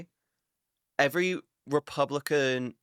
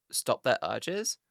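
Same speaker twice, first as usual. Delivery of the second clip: ordinary talk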